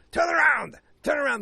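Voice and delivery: high, loud cry to turn back; panicked shout. Retold or imitated. Villain to the pilot, in a panic: high, loud yell. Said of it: "Mads Mikkelsen's like".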